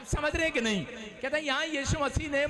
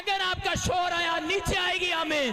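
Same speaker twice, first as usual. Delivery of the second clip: high, loud preacher's proclamation; loud high revival preaching; full-force preaching; 6 semitones higher, 4 dB louder than usual. Acted. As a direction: proclaim it over the crowd, shouting.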